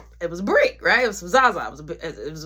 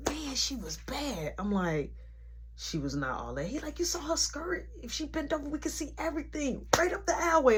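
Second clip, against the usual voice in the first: hushed gossip; 7 semitones higher, 7 dB quieter than usual. Retold or imitated. Mark whispering about a girl.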